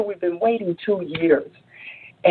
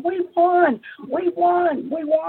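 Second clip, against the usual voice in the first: high-pitched victory cheer at normal volume; jubilant, high "screamed" cheer without real loudness; mock excited scream; 10 semitones higher, slower than usual. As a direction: act out screaming that the team has won. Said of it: "start screaming".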